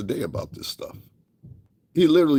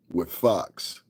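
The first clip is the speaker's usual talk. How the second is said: ordinary delivery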